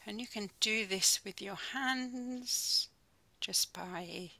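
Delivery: calm, unhurried mindfulness guide with long pauses; soothing body-scan voice, phrase by phrase; guided meditation. Real, not acted. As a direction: ease them gently into stillness, leaving space between phrases.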